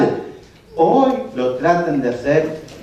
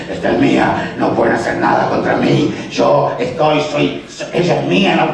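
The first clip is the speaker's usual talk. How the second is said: low, menacing demon voice; voice from beyond the grave; 3 semitones lower than usual. Retold or imitated. Preacher acting the demon's taunt, low and menacing. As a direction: growl it like the demon possessing her. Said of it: "habla con una voz de ultratumba".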